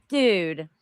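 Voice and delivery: usual speaking voice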